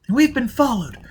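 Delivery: put-on high, urgent young woman's voice; Jiz's high voice. Jiz calling Foyle over the earphones, high and agitated. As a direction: pitch way up and press with worry.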